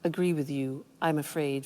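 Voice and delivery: quiet, low, courteous refusal; gently apologetic objection; polite disagreement. Shown as a demonstration. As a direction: soften the disagreement, keep it polite. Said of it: "So it's better to say".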